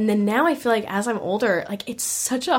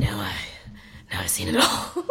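mock weathered voice; 4 dB quieter than usual. Mocking weathered self.

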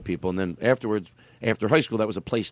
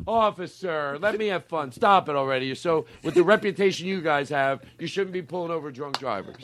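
put-on higher-pitched whiny drunk pleading with a cop; mock drunk driver; 6 semitones higher than usual. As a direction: play a drunk driver talking back to the officer, half joking.

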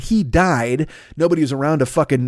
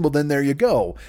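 ordinary talk, nothing marked

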